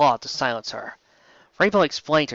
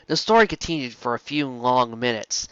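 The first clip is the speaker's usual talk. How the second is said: plain reading voice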